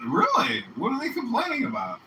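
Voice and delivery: high, loud mock disbelief; incredulous retort. Answering the bouncer's complaint with a loud, incredulous retort. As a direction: throw it back like you can't believe it.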